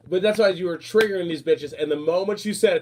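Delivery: normal talking voice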